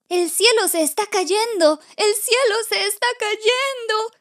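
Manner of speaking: high-pitched cry of a frightened little chick; high, alarmed storybook shout; panicked cry. Acted. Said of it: "grita Pollito Tito".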